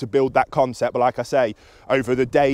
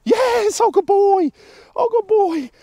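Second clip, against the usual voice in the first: very high, loud squeals of praise; excited praise; 6 dB louder, slower than usual. Real, not acted. Trainer rewards the dog for coming, excited praise. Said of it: "vocal inflection".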